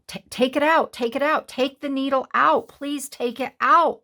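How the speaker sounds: high, loud, desperate begging; pleading in pain. Retold or imitated. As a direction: beg her to pull the needle out. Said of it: "I'm like".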